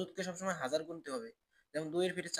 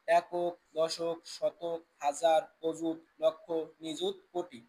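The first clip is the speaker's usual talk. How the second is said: slow, louder place-value chant; slow drilled count; 3 dB louder, much slower than usual. Shown as a demonstration. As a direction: count each place out slowly for the class. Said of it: "মুখস্থ রাখতে হবে". (ধীরে ধীরে জোরে গুনে বলা)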